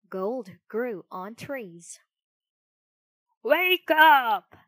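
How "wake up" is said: loud, high grumpy holler; grumpy call. A narrator's reading. Innkeeper rousing sleeping Pinocchio, grumpy shouting. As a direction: call out crossly at full volume. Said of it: "called a grumpy voice".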